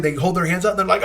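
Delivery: ordinary talk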